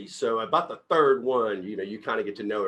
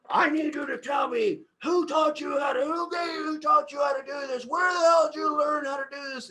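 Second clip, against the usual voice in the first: hammed-up yelling dad, much higher; angry dad. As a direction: bellow like an outraged TV father. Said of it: "and he goes".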